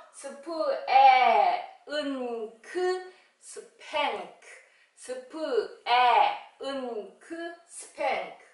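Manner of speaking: sounding out 스팽크 piece by piece, very slow; drawn-out phonics blend of 스팽크. Shown as a demonstration. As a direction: stretch each sound then blend. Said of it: "slow sound-by-sound blending".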